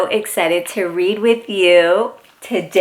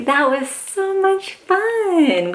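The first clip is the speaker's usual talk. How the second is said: put-on much higher, bright cheer for the child; excited praise; 8 semitones higher, slower than usual. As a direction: gush with delight to the little reader. Cheering after story ends.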